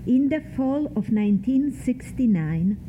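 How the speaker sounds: usual speaking voice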